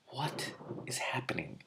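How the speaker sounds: slow unvoiced whisper, near silent. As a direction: breathe the question out slowly, no voice. Whispered aside in reading.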